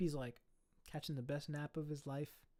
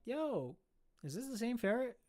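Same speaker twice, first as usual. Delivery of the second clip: much higher, thrilled recognition; excited question; 8 semitones higher than usual. Retold or imitated. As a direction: call back to the techs, amazed. Lady asks her techs.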